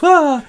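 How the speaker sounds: high, loud mock shriek; sudden waking yelp; startled shriek. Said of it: "And she goes".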